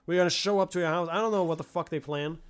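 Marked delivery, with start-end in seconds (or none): 0.0-1.1 hammed-up menace as if they're coming for you; shouting a mock threat at the streamer. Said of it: "they wanna be threatening".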